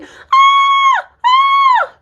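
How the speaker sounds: two high shrieking screams; granny screaming. Acted. Granny frightened by the wolf, two high shrieking screams. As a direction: shriek twice in terror, very high. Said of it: "she started screaming".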